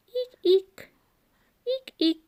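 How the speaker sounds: very high squeaks; squeaky shoe eeks. A narrator's reading. Reading aloud, squeaking like a shoe. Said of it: "like a squeaky shoe".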